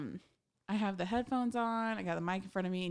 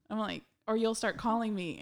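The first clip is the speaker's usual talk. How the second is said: ordinary talk, nothing marked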